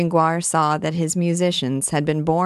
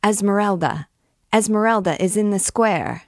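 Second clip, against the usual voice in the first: higher, narrated crowd-rousing cry; excited shout; 5 semitones higher than usual. A narrator's reading. Scamp at the window to the hall, shouting. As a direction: call the news out across the hall. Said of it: "shouted one of the young scamps".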